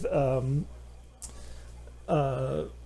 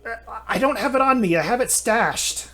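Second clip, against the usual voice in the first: frightened pleading; 7 semitones higher than usual. Acted. Captive pleads with captors.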